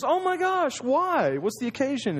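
high, thrilled gasp of a wife; overjoyed at the surprise; a wife thrilled by unexpected roses; delighted surprise. Acted.

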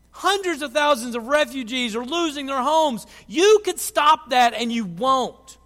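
loud, high, demanding cry to heaven; angry outcry at God. Retold or imitated. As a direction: hurl the question at God in anger. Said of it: "We, we want to yell out of it and say".

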